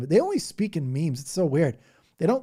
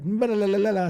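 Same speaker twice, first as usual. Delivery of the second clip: higher, louder babbling; mock speaking in tongues; 7 semitones higher, 5 dB louder, faster than usual. Imitating a lady at church speaking in tongues, higher and louder. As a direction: chant the nonsense like one of the ladies. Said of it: "saying this weird stuff".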